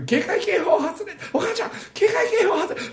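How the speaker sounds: put-on a boy announcing the alert to his mother; excited child calling. 子供の自分が母に叫ぶ. (母に警報を知らせる子供、高く叫ぶ)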